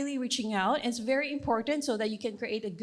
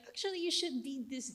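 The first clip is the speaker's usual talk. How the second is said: preachy tone; 3 semitones higher, 8 dB quieter than usual. Retold or imitated. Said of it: "my bias can still seep into my tone".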